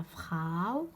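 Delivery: slow, stretched فخاو with a gliding into u; au diphthong. Shown as a demonstration. Teacher models the diphthong, slow فخاو with long au. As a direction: draw out the "ow" in فخاو. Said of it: "او كيما فخاو".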